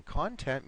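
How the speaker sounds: "content" said CON-tent, strong first syllable; first-syllable stress. Shown as a demonstration. Teacher defines the things inside, "content" stressed at the start. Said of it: "has a different meaning".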